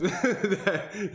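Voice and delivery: high burst of laughter; laughing. Real, not acted. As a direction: laughs at own joke. Laughs at own impression.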